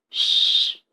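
long unvoiced shush. Ferdinand hushing Isabella, a forceful shush. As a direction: hush someone with a sharp hiss.

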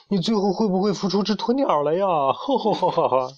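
high taunt ending in hooting laughter; tease, then hoot with laughter; teasing mockery. A narrator's reading. Young bird mocks the brooding hen, teasing.

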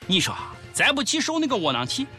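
ordinary talk

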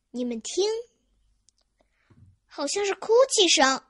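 high, loud note of surprise; surprised. Acted. Chick hears someone crying, surprised. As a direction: rise into astonishment. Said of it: "卡梅利多惊讶地说".

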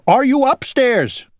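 high, urgent call; shouting. A narrator's reading. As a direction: holler the question up the stairs. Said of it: "he shouted".

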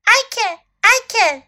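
high, loud eager piping up; volunteer keenly in a high voice; eager bird. A narrator's reading.

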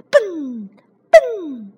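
high, ringing boing sounds; bouncing "boing". Acted. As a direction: sing out each bounce, falling away. Jumping sound effects. (高高弹起的蹦蹦声)

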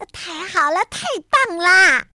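high cheer building to loud; delighted. A narrator's reading. Tiaotiao gets a bedtime story, delighted. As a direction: cheer with glee. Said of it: "高兴地说".

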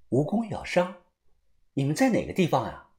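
distant caller asking; quiet phone voice; over the phone. A narrator's reading. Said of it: "电话那头范神医的声音".

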